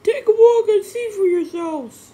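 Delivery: shouting, high and loud. A narrator's reading. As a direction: challenge them at full volume. George challenges them.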